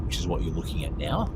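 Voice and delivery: normal talking voice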